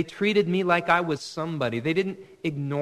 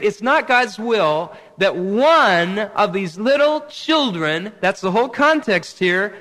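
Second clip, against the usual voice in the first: loud, higher, fervent preaching; preaching at full force; 3 semitones higher, 7 dB louder than usual. Acted. Pastor preaching to the congregation about lost children, fervent and loud. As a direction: drive the point home to the church.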